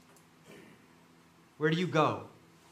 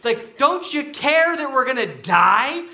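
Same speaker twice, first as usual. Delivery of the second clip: Peter's blurted panic; 7 semitones higher, 6 dB louder than usual. Retold or imitated. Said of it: "He's like".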